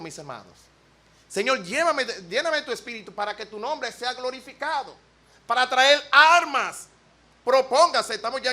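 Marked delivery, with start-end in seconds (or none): 1.3-6.9 prayer. Pretend. Voicing a prayer.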